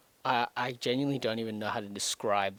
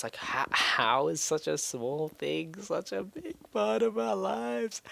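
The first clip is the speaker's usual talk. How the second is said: rising much higher and slower into outrage; exasperated disbelief; 10 semitones higher, slower than usual. Real, not acted. Venting to the camera about a curse, pitch soaring in exasperation. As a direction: stretch it out, climbing in disbelief.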